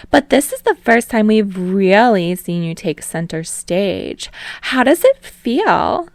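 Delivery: high, loud interviewer voice; gushing host. Acted. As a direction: ask it like a bubbly TV host.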